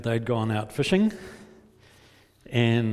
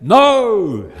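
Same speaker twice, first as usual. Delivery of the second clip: long loud shouted answer, high then falling; full-voiced far-off shout; yelled back; 8 semitones higher, 13 dB louder than usual. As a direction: holler it back across the distance. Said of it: "they probably yelled back".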